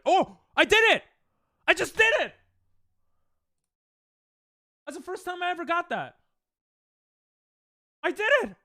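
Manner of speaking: real triumphant yelling, high and loud; excited shout. Real, not acted. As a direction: shout it out, thrilled you finally landed it. First successful trick, cheering.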